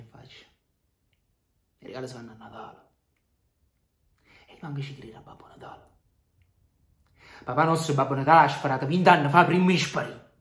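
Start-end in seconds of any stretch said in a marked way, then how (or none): 4.5-5.9 mocking whisper. Mocking reply in quarrel. 7.4-10.3 loud furious outburst; angry shout. Acted. Heated quarrel about father.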